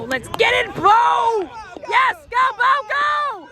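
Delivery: shouted sideline cheering; loud cheering. Real, not acted. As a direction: yell him down the field. Cheering child on field.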